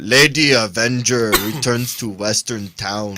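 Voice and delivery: slow dumb caveman voice. Pretend. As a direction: read it like a slow brute. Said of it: "in a caveman voice".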